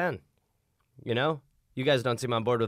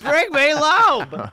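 slow, much higher and louder hammed-up decree; mock grand command; 12 semitones higher, 8 dB louder, much slower than usual. Demanding the man be brought in, hammed-up order. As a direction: bellow it like a pompous king.

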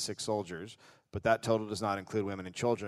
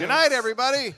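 mock sign-off; 13 semitones higher, 7 dB louder, faster than usual.

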